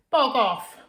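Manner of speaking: loud, high shout of "Bog off!". Acted. Girl shouting at the thing under her bed. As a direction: bark it out, startled awake. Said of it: "sat bolt upright ... and shouted".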